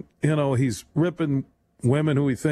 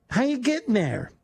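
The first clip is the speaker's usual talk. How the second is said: lightly voiced wife, higher and doubtful; a skeptical wife pressing her husband; a hint of a wife cross-examining; mock wife; 8 semitones higher than usual. Imagined wife questioning husband's trip.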